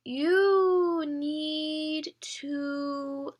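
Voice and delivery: drawn-out, stretched words; very slow talking. Acted. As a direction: say it in slow motion. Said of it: "trying to speak in slow motion".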